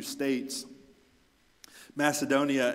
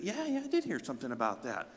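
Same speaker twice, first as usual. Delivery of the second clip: gossiping reply; 3 semitones higher, faster than usual. Acted. Neighbour replying about the church rumour, lightly gossipy. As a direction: answer fast, eager to share the rumour. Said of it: "people start to talk".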